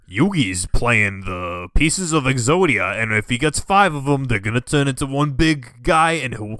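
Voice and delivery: put-on Joey's Brooklyn accent. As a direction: ham up a dubbed-anime Brooklyn accent. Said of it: "What was that accent supposed to be?".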